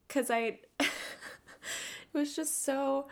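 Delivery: high-pitched, breaking into a laugh; real laughter interrupting the words. Real, not acted. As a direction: laugh helplessly mid-sentence. Amused disbelief retelling the moment.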